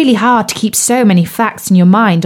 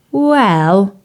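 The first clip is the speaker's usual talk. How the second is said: frog prince voice. Acted. Bertie the frog's voice.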